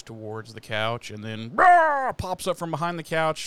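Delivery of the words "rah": sudden loud high roar, a quick mock monster growl; werewolf roar. Werewolf pops up in story.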